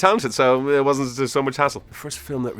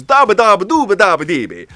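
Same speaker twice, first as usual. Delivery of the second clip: loud, high cartoon gibberish Italian; Peter Griffin mock Italian; 10 semitones higher, 10 dB louder than usual. Comparing his bad accent to a cartoon, loud mock-Italian babble. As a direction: blurt fake Italian babble like a cartoon oaf. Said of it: "like Peter Griffin from".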